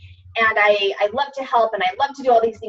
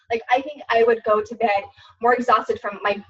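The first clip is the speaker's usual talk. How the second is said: usual speaking voice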